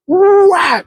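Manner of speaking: loud sharp whap with the mouth; imitated paddle smack. Paddle hitting classmate.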